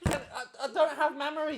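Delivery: loud, high, cheerful shout; shouted reply. Real, not acted. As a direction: yell it back gamely.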